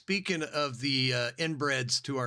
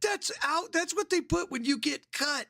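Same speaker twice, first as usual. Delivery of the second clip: retold quote. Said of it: "I was like".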